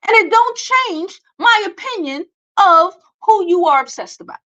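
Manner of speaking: ordinary talk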